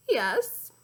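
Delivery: put-on pleasant Scottish accent; polite Scottish gent; mildly voiced Scottish accent; Scottish gentleman. A narrator's reading. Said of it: "pleasant voice he took from the Scottish gentleman".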